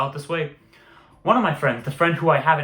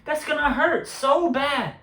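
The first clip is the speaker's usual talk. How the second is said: high, laughing incredulity; incredulous; 11 semitones higher than usual. Retold or imitated. Reacting to friend's absurd brag, high incredulous laughing. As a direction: exclaim in amused disbelief.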